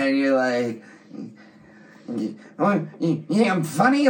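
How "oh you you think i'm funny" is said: higher-pitched mock gangster challenge; Joe Pesci. Friend quoting Goodfellas to co-host, higher mock-offended gangster voice. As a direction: act offended, playing the movie tough guy. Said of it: "Joe Pesci".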